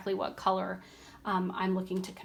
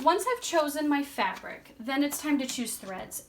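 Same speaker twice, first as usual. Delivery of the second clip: usual speaking voice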